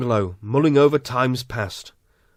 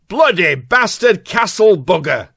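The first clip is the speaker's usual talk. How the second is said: slow, broad Yorkshire outburst, louder; Yorkshire shout; 2 semitones higher, 4 dB louder, slower than usual. Acted. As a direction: bellow it in restored Yorkshire. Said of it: "sitting up in bed and shouting".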